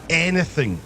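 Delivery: ordinary delivery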